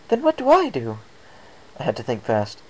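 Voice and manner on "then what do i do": high, worried lady unicorn; Rarity's voice. A narrator's reading. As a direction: raise the pitch, anxious and puzzled.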